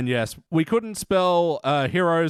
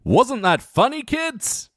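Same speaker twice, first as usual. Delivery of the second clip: mock kids host; 8 semitones higher, 3 dB louder, slower than usual.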